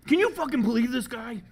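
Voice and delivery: high, loud outraged demand; hammed-up indignant yell; imitated worked-up rage. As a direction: act out a worked-up colleague's outrage. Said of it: "He's so worked up".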